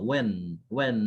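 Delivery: when said as plain w-en, no h; when, clean w start with no h. Shown as a demonstration. Teacher models when without the h. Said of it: "এখানে এইচ থাকবেন না".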